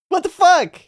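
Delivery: high, loud disbelieving outburst; shocked. Real, not acted. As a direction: blurt it out in real shock. Shocked at bad start.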